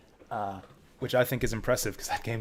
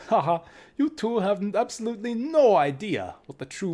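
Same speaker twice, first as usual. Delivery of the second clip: higher, hammed-up German accent for the doctor; 8 semitones higher, 3 dB louder than usual. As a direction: play the scientist with a mock German accent. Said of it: "I wanna give Doctor Klomp a German accent".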